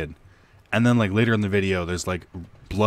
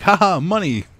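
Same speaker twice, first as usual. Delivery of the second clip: higher, louder hammed-up gloating; mock greedy glee; 9 semitones higher, 5 dB louder than usual. Mocking parents profiting from abuse, hammed-up gloat. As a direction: gloat like a cartoon money-grabber.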